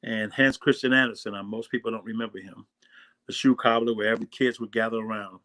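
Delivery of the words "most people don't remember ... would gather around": ordinary delivery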